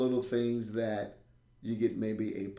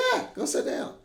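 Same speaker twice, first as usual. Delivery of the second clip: higher, breezy go-ahead; high coaxing teacher; 5 dB louder than usual. Retold or imitated. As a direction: shrug him off to the bench. Teacher humouring kid.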